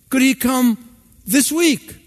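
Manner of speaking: a hint of an eager small girl; lightly voiced little girl. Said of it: "girl asks mother".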